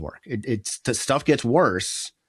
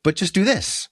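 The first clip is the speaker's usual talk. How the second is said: higher-pitched mock insistence; insistent advice; 7 semitones higher than usual. Would-be helper to sad friend, insisting in a higher voice. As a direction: push the easy fix on them.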